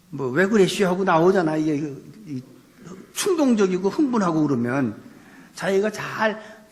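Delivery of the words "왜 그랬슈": loud, higher-pitched Chungcheong dialect blurt. Acted. Preacher showing how excited people lapse into dialect, flustered Chungcheong accent. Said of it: "충청도도 있고 이게 지방 방언들이".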